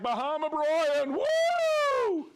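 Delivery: loud, high whoop on the name; excited cheer. Real, not acted. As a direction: hype him up like a crowd favourite. Cheering a longtime viewer.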